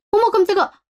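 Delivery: high startled gasp of words; sudden fright at the door. Acted. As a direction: jump as if caught off guard. Startled at finding someone by the door.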